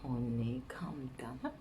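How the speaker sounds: imitated husband, low and soft; soothing. Husband calming his angry wife with pet names, soothing. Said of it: "when he sees I'm angry".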